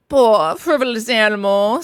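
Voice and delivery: slow, superior put-down; lofty. A narrator's reading. Jealous Toad dismissing Mole, lofty. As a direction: look down on him with slow scorn. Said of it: "said Toad very loftily".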